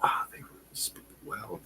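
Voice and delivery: near-whispered, impressed aside; hushed awe. Retold or imitated. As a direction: breathe it out in quiet admiration. Voicing an impressed listener's reaction.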